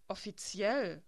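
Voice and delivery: pitying, scornful echo of the word; mocking echo. A narrator's reading. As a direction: throw the word back with disdain. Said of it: "mit einer Mischung aus Mitleid und Spott". (spöttisch-mitleidiges Echo)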